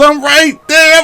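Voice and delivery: incredulous. Real, not acted. Defender beaten on replay.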